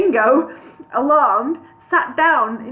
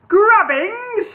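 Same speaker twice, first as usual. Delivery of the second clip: high shrieked name; 11 semitones higher, 7 dB louder than usual. Acted. Old wizard shrieks the hobbit's name, high and loud. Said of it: "shrieked the wizard".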